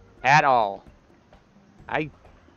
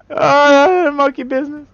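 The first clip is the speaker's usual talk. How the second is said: high, laughing cries through the words; high wheezing laughter between words; laughing hysterically; 7 dB louder than usual. Real, not acted. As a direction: crack up helplessly at yourself. Laughs at own failing monkey.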